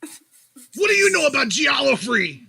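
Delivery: loud, high offended rant. Retold or imitated. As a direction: rant like you're deeply insulted. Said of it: "get all offended and say".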